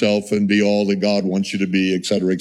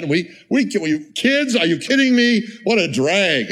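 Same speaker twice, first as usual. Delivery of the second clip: high, scoffing mock disbelief at kids; mocking worldly parent; 6 semitones higher than usual. Preacher mocks parents who scorn having kids, scoffing. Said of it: "there are people talk like this".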